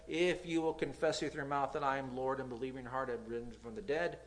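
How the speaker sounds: ordinary delivery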